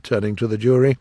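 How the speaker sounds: usual speaking voice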